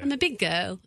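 put-on "go" in place of "girl"; dropped R's. Mother repeating her daughter's words, "girl" said as "go". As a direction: drop the R so "girl" becomes "go". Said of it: "she just can't say her R's".

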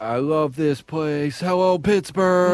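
slow, drawn-out presidential impression; Donald. Co-host attempting the president's rally greeting, slow impression. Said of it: "You do, you do, Donald".

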